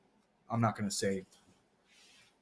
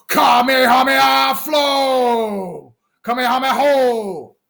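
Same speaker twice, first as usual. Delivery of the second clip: much higher, louder, slow drawn-out shouted attack call; anime battle cry; 9 semitones higher, 5 dB louder, slower than usual. Pretend. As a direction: yell it like a cartoon hero charging an energy blast.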